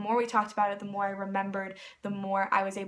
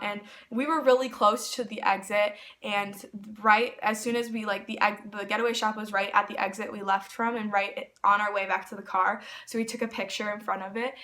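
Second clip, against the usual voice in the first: normal talking voice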